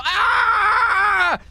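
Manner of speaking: drawn-out high, loud yell; Point Break style scream. Acted. Witness shows the court his firing scream, long high yell. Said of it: "Like Point Break style".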